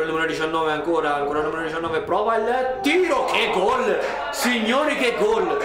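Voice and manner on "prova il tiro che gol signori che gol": drawn-out goal cry, higher; excited goal call. Real, not acted. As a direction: shout the goal with joy. Goal scored, live commentary.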